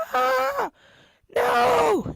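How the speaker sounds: high, loud screams of terror; terrified scream. Acted. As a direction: scream like facing execution. Victims about to be executed.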